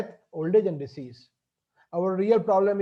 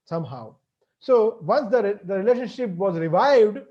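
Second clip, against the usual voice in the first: usual speaking voice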